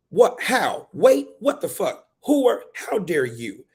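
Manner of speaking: high, loud, incredulous questions; indignant outburst. Acted. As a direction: fire back as if insulted. Book's parent outraged at question.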